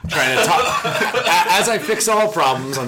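laughing helplessly while talking; laughing through tears. Real, not acted. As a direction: talk through real laughter. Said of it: "I got tears running down my face".

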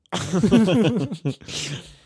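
high rolling laugh; loud laugh. Real, not acted. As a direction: laugh hard and long. Long laugh.

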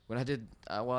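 ordinary talk, nothing marked

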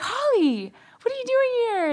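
high-pitched, delighted surprise; excited greeting. Retold or imitated. Friend running into an old schoolmate, excited greeting. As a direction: greet an old friend in an imitated happy squeal.

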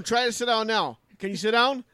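urging crew. Retold or imitated. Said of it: "they're like telling him".

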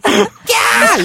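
loud high-pitched squeal; hammed-up shriek of glee; short piercing "kyaa"; excited squeal.

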